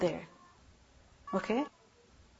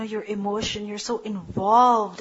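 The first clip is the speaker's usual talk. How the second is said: ordinary talk, nothing marked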